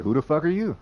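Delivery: the woman brushes off a stranger, high and rude; rude challenge. Retold or imitated. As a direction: spit it at a nobody. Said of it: "she'd be like".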